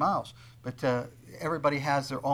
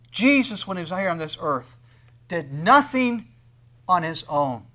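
preaching emphasis; 3 semitones higher, 5 dB louder than usual. Acted. Preaching at full force.

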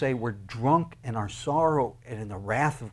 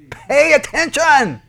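shouted command; 11 semitones higher, 8 dB louder, slower than usual. Acted. Preacher shouting God's command.

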